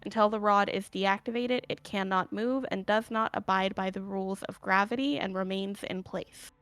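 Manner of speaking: normal talking voice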